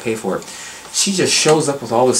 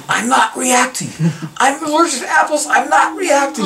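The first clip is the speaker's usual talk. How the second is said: excited yelling; 13 semitones higher than usual. Retold or imitated. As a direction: shout it out like a thrilled kid amazed he's fine. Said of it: "starts yelling at the top of his lungs".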